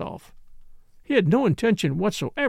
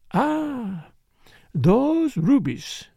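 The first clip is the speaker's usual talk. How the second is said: higher, slow, rapturous sigh; dreamy longing; 7 semitones higher, much slower than usual. A narrator's reading. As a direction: linger longingly over the words. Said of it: "Her eyes grew misty, a faraway light".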